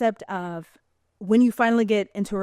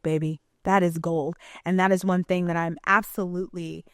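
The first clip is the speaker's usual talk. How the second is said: usual speaking voice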